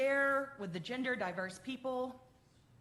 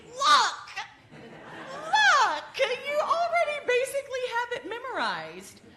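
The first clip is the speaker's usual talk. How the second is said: much higher and louder, laughing as she cries out; delighted shout; 14 semitones higher, 12 dB louder than usual. Real, not acted. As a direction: cheer them on, thrilled and laughing. Delighted at congregation's recall.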